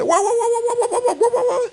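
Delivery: small dog barking a warning; yappy chihuahua barks. Retold or imitated. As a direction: imitate a tiny chihuahua going off. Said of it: "ellos empezaban".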